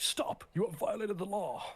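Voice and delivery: Imperial Guard voice. Retold or imitated. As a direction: bellow the law at a petty criminal. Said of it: "he just does that voice over the intercom".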